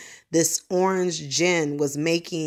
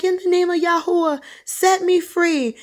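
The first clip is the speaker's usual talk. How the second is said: high, loud desperate plea; retold screamed prayer; 13 semitones higher, 4 dB louder than usual. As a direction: imitate him crying out for deliverance. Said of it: "he was screaming this loud".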